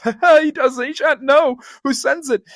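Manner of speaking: high, laughing glee; splitting with a laugh. Acted. Scrooge plotting a gift, high laughing glee. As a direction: laugh through the words. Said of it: "splitting with a laugh".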